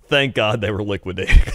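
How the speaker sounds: ordinary delivery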